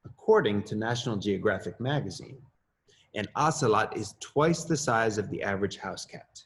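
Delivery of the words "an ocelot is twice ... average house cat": usual speaking voice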